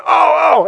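high mock shout of "oh"; high-pitched imitated outcry; imitating excited kids. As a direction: whoop it like kids watching a movie. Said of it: "my kids are going".

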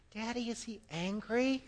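put-on very soft child's question; imitated kid. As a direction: quote a timid little question to Daddy. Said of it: "said what some kid said".

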